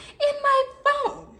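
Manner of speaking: high, drawn-out indignant echo; mock outrage way up high; outraged squeal. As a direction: repeat it in a squeaky, stretched voice. Outraged echo of her own words.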